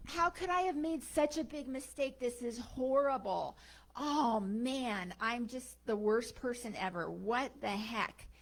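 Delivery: loud, high, drawn-out wail of self-blame; hammed-up despair, wailing at yourself; really upset. Woman berates herself over lost diamonds, really upset. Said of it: "starts to get really upset".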